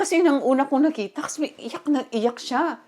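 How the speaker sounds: ordinary talk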